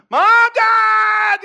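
full-force high scream, imitating a child. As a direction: scream for your parents in panic. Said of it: "I'm, like, screaming".

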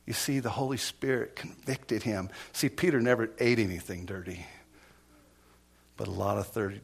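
normal talking voice

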